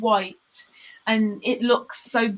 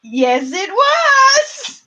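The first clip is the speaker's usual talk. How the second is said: high, loud, drawn-out squeal; excited shriek; 11 semitones higher, 7 dB louder, much slower than usual. Real, not acted. Host replying to contestant who says he's jumping, shrieked. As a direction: squeal it, stretching the last word.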